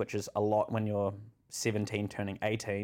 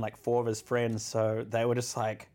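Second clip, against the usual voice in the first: usual speaking voice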